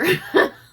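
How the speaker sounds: high, loud laughing burst; burst of laughter. Real, not acted. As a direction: let a real laugh break out. Laughing at unlikely moment.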